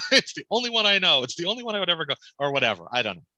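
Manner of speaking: ordinary delivery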